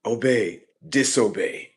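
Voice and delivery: acted commands. Taunting the robot with order and refusal, loud commanding. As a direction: call each word out forcefully.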